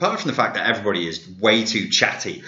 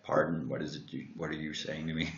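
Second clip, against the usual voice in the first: put-on low, soft Texas accent; puzzled Texan customer, quiet and low; imitated Texan; 4 semitones lower, 12 dB quieter than usual. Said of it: "He sounded like he was from Texas".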